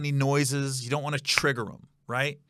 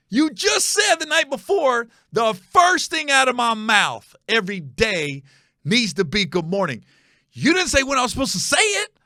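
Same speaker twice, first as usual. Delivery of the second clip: exasperated protest; 8 semitones higher, 5 dB louder than usual. Retold or imitated. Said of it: "And I go".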